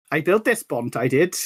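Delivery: imitated Welsh local man; Welsh accent. Welsh man accosting holidaymakers on a bridge, Welsh accent. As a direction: put on a Welsh accent, proudly claiming the work. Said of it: "this Welsh man wanders by and goes".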